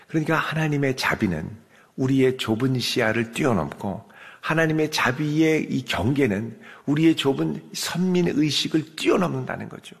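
ordinary talk

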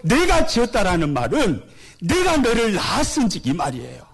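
usual speaking voice

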